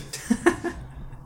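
high, breathy burst of laughter; high giggle after the joke; laughing. Real, not acted. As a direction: laugh at your own cheeky joke. Laughs at his own rude remark.

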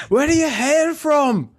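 high, slow mock Aussie voice; Australian accent. Aussie at the bar quizzing him, mock Australian accent. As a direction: ham up an Aussie stranger asking. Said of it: "He's like".

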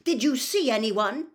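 loud, high, impatient demand. A narrator's reading. Mister Diggory interrogating the elf, impatient. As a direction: fire the question at the elf. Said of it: "said Mister Diggory impatiently".

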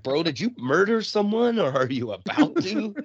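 half-laughed disbelief; laughing mock question. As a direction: tease the sweaty guy while cracking up.

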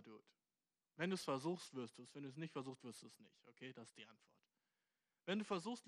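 normal talking voice

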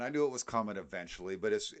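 ordinary talk

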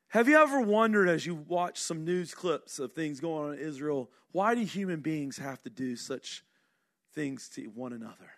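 ordinary talk

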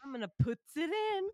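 high-pitched comic psych-up voice; goofy imitation of a man psyching himself up; Paul Rudd. Host retells Rudd's mirror pep talk, high silly impersonation. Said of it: "he just starts talking to himself".

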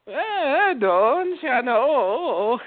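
high, slow, sing-song mock Minnesota accent. Friend hunts for an accent phrase: mock Minnesota accent. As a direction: stretch out don't you know, Minnesota style. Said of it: "got me in the mode".

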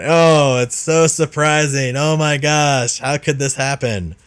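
sarcastic mock surprise. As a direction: overplay the shock to mock how obvious it was.

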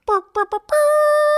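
high buzzing trumpet noise; trumpet fanfare. Pretend.

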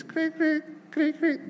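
high clicky cricket chirps; cricket impression. Shown as a demonstration. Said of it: "my cricket impression".

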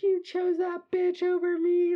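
very high, loud mock wail; hammed-up tearful shriek; drunken wailing accusation. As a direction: imitate a crying friend screeching blame. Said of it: "like, just nonstop".

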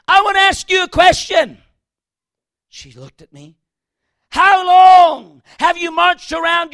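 loud, hard challenge; confronting shout. Retold or imitated. Preacher confronting the woman at the front, loud. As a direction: call her out to her face.

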